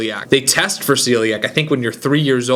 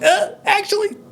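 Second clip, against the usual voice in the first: high-pitched, hesitant mock correction; acted-out sheepish interjection, pitched way up; awkward correction. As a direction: squeak out an awkward, reluctant correction.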